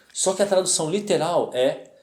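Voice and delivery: ordinary talk, nothing marked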